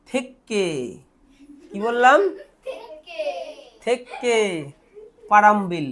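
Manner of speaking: থেকে said on its own with a held double k; pronunciation model. Shown as a demonstration. Teacher showing students how to pronounce the next part, থেকে set apart. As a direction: say থেকে slowly as a separate piece. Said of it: "চলো এটাকে উচ্চারণ করি".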